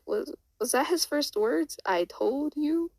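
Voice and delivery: high, climbing disbelief; acted astonishment, rising steeply at the end; incredulous question. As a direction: ask it in stretched, high-pitched shock. Carol stunned by baby talking.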